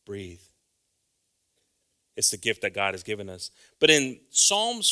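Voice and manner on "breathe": usual speaking voice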